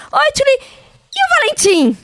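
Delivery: high, chirpy greeting; nosy acquaintance. Retold or imitated. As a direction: imitate someone cheerfully checking up on a mother. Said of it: "e a pessoa fala assim".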